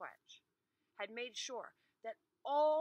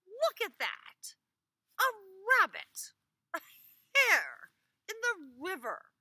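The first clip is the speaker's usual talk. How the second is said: amazed wife; 12 semitones higher, 13 dB louder than usual. Acted. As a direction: point and marvel.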